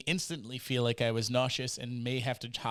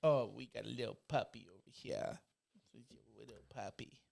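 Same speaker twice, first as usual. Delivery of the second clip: put-on soft, hushed baby talk to a little dog; softly fussing over a puppy; cooing at a puppy; 3 semitones lower, 8 dB quieter than usual. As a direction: coo quietly over an imaginary puppy.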